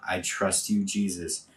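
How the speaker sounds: quiet whispered-toward devotion; soft response. Shown as a demonstration. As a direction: drop to a soft, intimate response. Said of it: "I'm gonna say".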